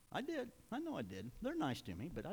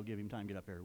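ordinary talk

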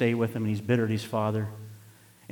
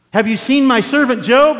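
much louder and higher, bragging; proud booming boast; 13 semitones higher, 14 dB louder than usual. Acted. God bragging to the devil about Job, booming. God's line quoted twice.

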